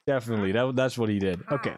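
usual speaking voice

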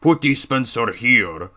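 put-on slow, heavily stretched accented game-character voice; Heavy from TF2. YouTuber demoing the game voice he borrows from, slow character impression. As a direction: drag it out as a heavy Russian-style brute. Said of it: "a bit like Heavy from T F two".

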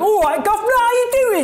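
high, hammed-up fake Cockney greeting; mock British accent. American imitating a Brit to someone living in England, exaggerated British accent. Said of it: "some American comes along and is like".

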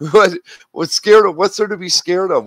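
high, loud disbelief; what is there to fear, loudly; incredulous. Real, not acted. Incredulous rant.

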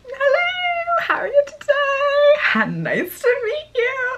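hammed-up very high gushing hello; starstruck fangirl. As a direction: overdo the dazzled fan meeting him. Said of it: "I was like".

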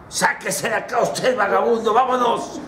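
high, scornful order to leave; acted irritated gatekeeper; dismissive guard. Guard chases off a vagabond.

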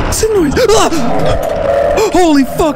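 high panicked yelling; terrified yell. Real, not acted. Streamer jump-scared by a sewer monster, high panicked yell. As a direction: scream it in fright.

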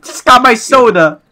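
high, loud shouts; excited yelling. Real, not acted. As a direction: yell out in excitement. Yelling at friend in game.

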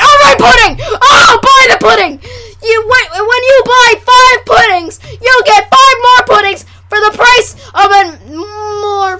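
loud, high, hammed-up pitchman; shouted mock sales pitch. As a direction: holler the bargain at the viewers. Resumes shouted mock commercial.

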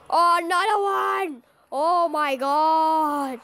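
high, drawn-out groaning cry; exasperated wail. Real, not acted. Player hit by yet another one, exasperated wail. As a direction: wail it out, stretching the last words.

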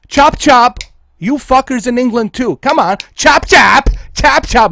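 loud, high mocking shout; taunting shout. Real, not acted. Streamer yelling at viewers to pay up, taunting. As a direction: hurry them along at full volume.

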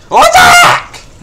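real startled yell; startled shout. Real, not acted. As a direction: yelp in disbelief, slow. Shocked by the game.

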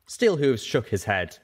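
plain reading voice

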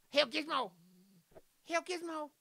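high-pitched chant of praise; mock hailing. Streamer honouring the dog Gizmo, high mock hailing. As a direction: hail a pet like a tiny cult follower, pitched high. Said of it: "That's why we".

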